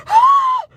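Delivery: sharp high shriek; retold scream. Telling viewers how she shrieked at the dog, sharp shriek. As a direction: let out a short high shriek.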